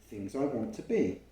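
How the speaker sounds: ordinary delivery, nothing marked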